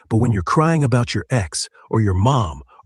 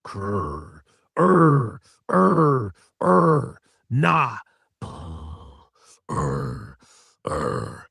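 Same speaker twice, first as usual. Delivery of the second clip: dinosaur voice; much slower than usual. Pretend. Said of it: "let's get the adult version".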